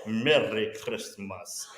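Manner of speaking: low, slow, laughing spy voice; creepy Russian spy. Acted. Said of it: "sounds like a creepy Russian spy".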